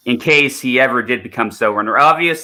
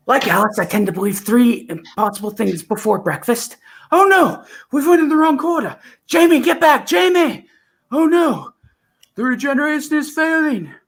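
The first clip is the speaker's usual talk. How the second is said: Colin Baker Doctor. Acted. Acting the sixth Doctor for a friend, high and alarmed. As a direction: cry out to Jamie as the regeneration fails. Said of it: "let me try the fifth and the sixth".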